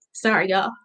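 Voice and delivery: quiet, choked-up apology; holding back tears. Real, not acted. Presenter apologizing to the audience mid-pitch, holding back tears. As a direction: apologize softly while fighting real tears. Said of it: "I'm trying not to cry".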